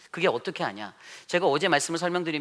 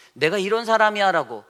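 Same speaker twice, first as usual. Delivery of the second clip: higher and louder, puffed-up self-importance; mock swagger, raised and loud; arrogant boast; 7 semitones higher, 9 dB louder than usual. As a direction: brag like someone demanding respect. Preacher mimics arrogant boast.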